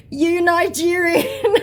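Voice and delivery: high, slow, laughing sneer; sneering scammer voice. Retold or imitated. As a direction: spit the insult out with a laugh. Reading out the scammer's insult.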